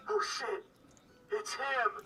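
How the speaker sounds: muffled voice. Acted. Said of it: "muffled voice from behind the door".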